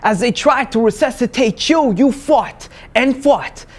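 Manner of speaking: high, loud declaiming, building to a peak; rising, urgent spoken word delivery; impassioned slam poetry. Acted. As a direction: hammer each refrain with full force. Slam poem builds in force.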